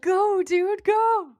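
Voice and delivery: high, loud cheering; excited cheering. Retold or imitated. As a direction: cheer on like a fan. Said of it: "everybody was like".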